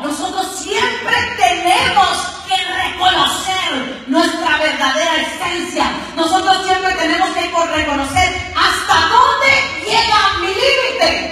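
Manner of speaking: loud, slow, emphatic exhortation; preaching at full force. Acted. Preacher exhorting the church to know its limits, loud and slow. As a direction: press each phrase on the listeners.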